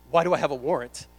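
loud, indignant string of questions; retold panic, voice pushed up in volume; alarmed disbelief. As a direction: demand an explanation, loudly. Acts out shock at the warrant.

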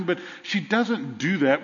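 usual speaking voice

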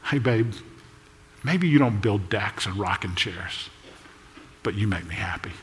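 quiet husband's tenderness; tender reassurance. Acted. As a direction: comfort her gently. Husband reassuring his wife tenderly.

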